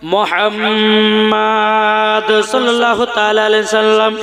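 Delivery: loud, fast intoned storytelling; melodic waz preaching. Acted. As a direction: intone the tale at full voice, stretching phrase ends. (সুরেলা ওয়াজের বয়ান)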